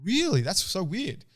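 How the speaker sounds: high-pitched mock surprise; feigned surprise. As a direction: act puzzled, pitch jumping up on "Really".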